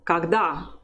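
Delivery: Когда as "kag-DA", falling at the end; teacher's model Когда, strong last "a"; final-syllable stress. Shown as a demonstration. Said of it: "strength of the voice is still on the stressed syllable".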